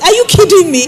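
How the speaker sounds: high, loud disbelieving cry; shocked disbelief. Retold or imitated. Counselor to the unfaithful husband, high loud disbelief. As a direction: blurt it out, stunned and outraged. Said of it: "I'm like".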